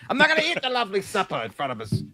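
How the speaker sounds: put-on sulky kid refusing supper; mock bratty kid. Mock kid refusing to eat the supper.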